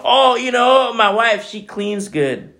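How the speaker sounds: lightly mock a husband praising his wife; mock boasting husband. Husband praising his wife's cleaning, mock boasting.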